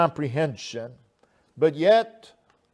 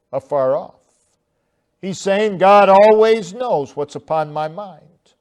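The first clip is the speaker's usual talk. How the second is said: rising, louder pulpit delivery; fervent preaching; 3 semitones higher, 6 dB louder than usual. Acted. Preacher stirring his congregation, fervent preaching. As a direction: press the point home to the congregation. Preacher expounds verse two.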